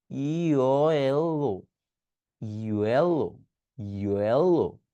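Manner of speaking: "Yellow" drawn out very slowly; slow motion. Shown as a demonstration. Teacher contrasting a slow yellow with a direct one. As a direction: stretch every part of "yellow". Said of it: "slow মোশনে বলতেছি".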